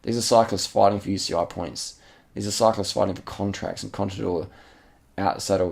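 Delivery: ordinary talk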